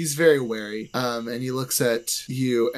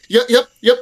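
much higher and louder, startled yep yep yep; panicked yeps; 10 semitones higher, 8 dB louder than usual. Acted. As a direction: blurt it out in sudden fright. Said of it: "he immediately freezes up".

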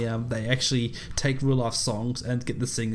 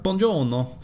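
mock Italian; 4 semitones higher, 3 dB louder than usual. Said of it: "If you speak Italian with an American accent".

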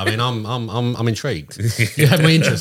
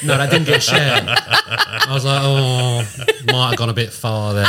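laughing; 3 semitones higher, 5 dB louder, slower than usual. Retold or imitated. Retold regret, laughing.

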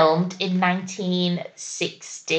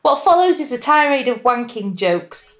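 usual speaking voice

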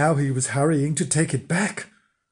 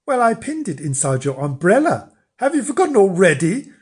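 bossy wife; 5 semitones higher, 5 dB louder than usual. Acted. Wife reproaches him.

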